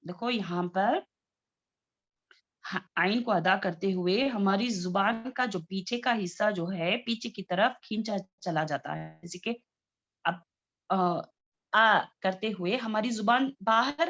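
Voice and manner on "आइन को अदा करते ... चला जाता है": ordinary talk, nothing marked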